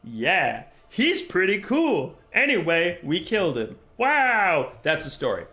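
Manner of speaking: hammed-up retelling of a dull fantasy chat, a touch of wonder; mock book dialogue. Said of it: "and they go".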